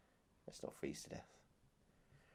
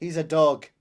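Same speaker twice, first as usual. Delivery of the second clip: loud villain line; 3 semitones higher, 9 dB louder than usual. Retold or imitated. Quoting the film's villain.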